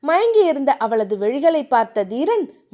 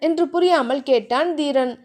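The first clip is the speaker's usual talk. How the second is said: plain reading voice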